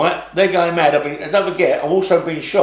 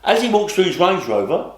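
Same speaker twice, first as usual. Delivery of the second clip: normal talking voice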